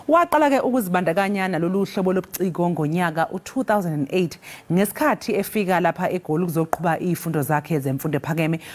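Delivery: ordinary talk, nothing marked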